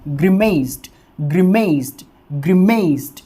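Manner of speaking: ग्रिमेस्ड stressed on the m sound, ending in t; teacher stressing the m in ग्रिमेस्ड. Shown as a demonstration. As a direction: lean on the m in ग्रिमेस्ड. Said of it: "म वाली sound के ऊपर देना है".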